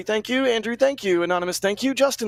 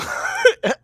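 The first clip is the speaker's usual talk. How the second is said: laughing. Real, not acted. Laughing while reading names.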